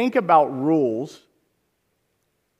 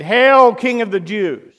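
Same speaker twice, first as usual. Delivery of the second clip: mocking hail; 4 semitones higher, 7 dB louder than usual. Mockers at crucifixion.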